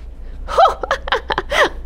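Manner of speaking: a high airy laugh; giggling storyteller; breathy laugh. Real, not acted. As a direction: laugh at the silly secret. Laugh after caw.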